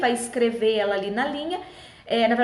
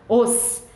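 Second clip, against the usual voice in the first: "os" isolated and punched; loud, separate "os" as a syllable demo; stressed syllable alone; 4 dB louder than usual. Shown as a demonstration. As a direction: sound out the strong ending "os" by itself. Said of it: "Ros, é o ros final".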